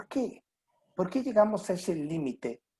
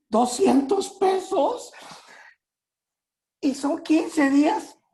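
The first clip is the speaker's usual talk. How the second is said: high, slow wail of money panic; much higher, drawn-out lament; mock despair; 13 semitones higher, 6 dB louder, slower than usual. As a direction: whine that it won't last the fortnight. Despair over money left.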